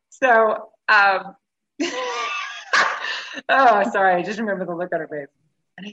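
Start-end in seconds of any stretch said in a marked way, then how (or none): 1.8-3.4 high, helpless laugh; real laughter; burst of laughter. Real, not acted. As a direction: laugh at the memory. Laughing at the memory.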